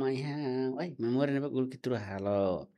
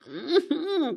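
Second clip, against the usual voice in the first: high burst of laughter; real high laugh; 13 semitones higher than usual. Real, not acted.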